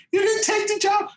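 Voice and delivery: high incredulous squeak, imitating Neil.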